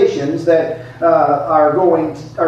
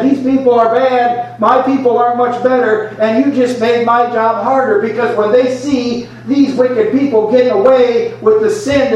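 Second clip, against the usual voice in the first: upset Jonah; 7 semitones higher than usual. Acted. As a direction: push back hard at God. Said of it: "And Jonah said".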